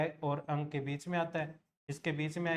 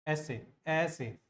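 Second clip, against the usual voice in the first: slow dictation; much slower than usual. Shown as a demonstration. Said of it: "लिखते कैसे हैं अपने".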